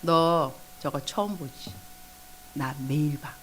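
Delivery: soft, slow, low-key reply; Holy Spirit. Retold or imitated. Speaking to a shocked believer at prayer, quiet knowing reply. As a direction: answer quietly and unhurriedly. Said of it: "성령님 말씀하시죠".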